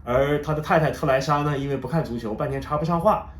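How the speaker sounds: usual speaking voice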